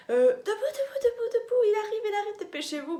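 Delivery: high, loud, laughing panic; panicked shout. Retold or imitated. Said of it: "prise de panique".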